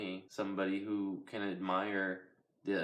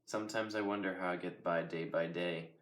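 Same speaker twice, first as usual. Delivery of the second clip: ordinary talk, nothing marked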